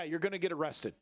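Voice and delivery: ordinary delivery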